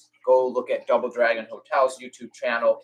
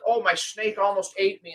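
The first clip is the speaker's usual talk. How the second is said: mock scared YouTuber; 7 semitones higher than usual. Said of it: "and they'll look scared".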